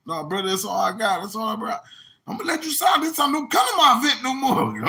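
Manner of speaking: high, quick put-on pleading; hammed-up excuse, rushing; mock guest voice. Guest pleading with the club owner, high and rushed.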